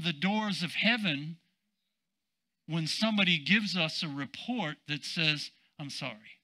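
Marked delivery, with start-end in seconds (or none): 5.8-6.4 very soft, low acted apology; hushed bad news. Preacher voicing a bad medical report, hushed acted apology. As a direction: sink almost to nothing for the apology.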